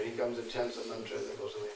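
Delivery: usual speaking voice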